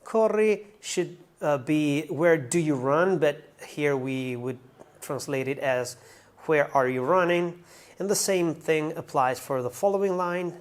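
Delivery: normal talking voice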